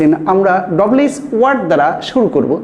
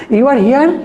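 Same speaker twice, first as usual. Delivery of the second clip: higher-pitched surprised intonation question; 6 semitones higher, 4 dB louder, much slower than usual. Shown as a demonstration. Teacher shows students an intonation question, surprised. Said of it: "Intonation question means to show the surprise".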